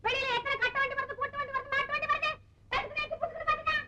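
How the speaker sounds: high, rushing scold; frantic scolding. Acted. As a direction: berate the husband in a panic. Wife scolds husband frantically. (கணவனைப் பதற்றத்துடன் திட்டுதல்)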